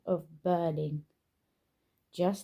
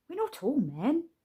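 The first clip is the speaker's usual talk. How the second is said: put-on higher girl's objection; 8 semitones higher than usual. A narrator's reading. Laura correcting her dad, higher girl's voice. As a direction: pitch it up like a young girl.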